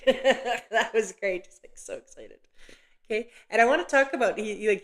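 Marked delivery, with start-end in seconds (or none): 0.0-2.4 real laugh running through the words, drawn out slowly. Real, not acted. Medium laughing at the father's eager reply, slow delighted laugh.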